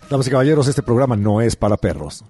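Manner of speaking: deep, booming announcer. Pretend. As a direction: announce it to the audience, low and loud. Mock announcement to listeners.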